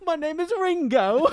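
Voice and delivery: high, loud hammed-up British accent; mock British accent. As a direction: belt out a silly British introduction. Playing a fake British Beatle name.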